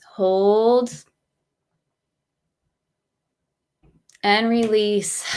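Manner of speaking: slow, measured breath count with drawn-out cues; guided breathing meditation. Real, not acted. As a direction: guide them through each breath, unhurried.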